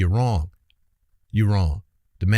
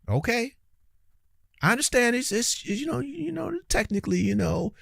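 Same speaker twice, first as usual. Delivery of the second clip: mock soft concession; 10 semitones higher, 6 dB quieter than usual.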